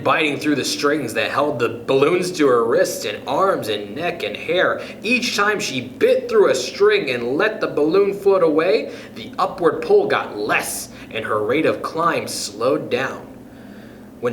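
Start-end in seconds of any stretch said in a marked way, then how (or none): none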